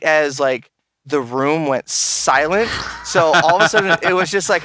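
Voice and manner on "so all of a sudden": ordinary talk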